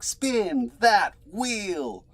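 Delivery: high, slow, booming host call; game show host. Pretend. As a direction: stretch it out like a TV host spinning the wheel. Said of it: "I feel like I should say something like".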